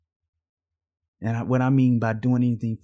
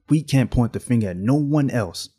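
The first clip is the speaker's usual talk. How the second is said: ordinary talk, nothing marked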